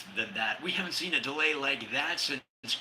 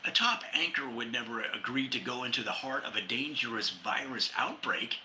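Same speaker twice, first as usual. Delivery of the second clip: normal talking voice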